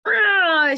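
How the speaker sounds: loud high cry as the words fail; a strangled cry instead of the word; choked distressed cry. Retold or imitated. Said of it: "she could not say it".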